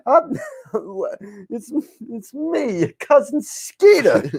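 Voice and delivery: high, slow, hammed-up cousin Skeeter; goofy cousin voice. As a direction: introduce yourself as the dopey cousin. Mock cousin Skeeter intro.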